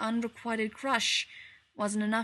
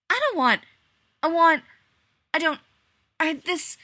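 high, loud stammering; flustered; 8 semitones higher, 9 dB louder than usual. A narrator's reading. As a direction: trip over the words, flailing. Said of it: "John flailed helplessly".

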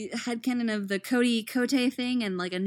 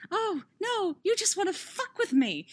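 high-pitched mock complaint; playful exasperated grumble; mock whine; 9 semitones higher than usual. Real, not acted. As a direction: whine it, pretending to be wronged.

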